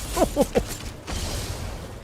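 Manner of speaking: high, soft breathy laugh fading; snooty titter. Acted. Aristocrat sneering at the peasant, fading titter. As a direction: trail off in a smug noble laugh. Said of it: "aristocrat voice".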